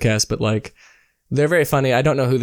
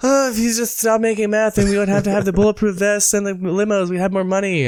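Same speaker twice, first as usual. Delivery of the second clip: much higher, put-on wife voice; high character voice; 7 semitones higher than usual. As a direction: play the other side, pitched up. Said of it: "and then I have to go".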